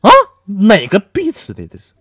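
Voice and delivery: wife finds long hair and yells; angry wife. Retold or imitated. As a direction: demand whose hair, furious. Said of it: "揪起我就是一顿狂扇呐".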